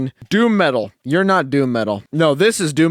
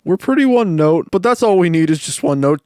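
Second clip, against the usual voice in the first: usual speaking voice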